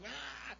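put-on toddler scream.

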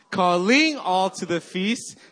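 "Calling" with LING punched loud, slow; stress on second syllable. Shown as a demonstration. Pastor leading church vision chant, stress on LING of "Calling". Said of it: "you emphasize every syllable".

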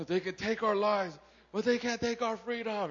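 lightly voiced defiant warrior speech; Braveheart hero. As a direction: pastor quoting the Braveheart hero, mildly heroic. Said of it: "he's like".

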